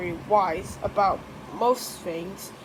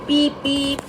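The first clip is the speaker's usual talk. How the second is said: car horn beep; 3 semitones higher, 5 dB louder than usual. Pretend.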